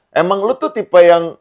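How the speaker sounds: usual speaking voice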